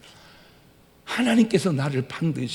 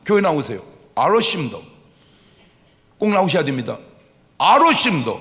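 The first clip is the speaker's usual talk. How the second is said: Gyeongsang exchange; 7 dB louder, faster than usual. Retold or imitated. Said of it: "그게 경상도예요".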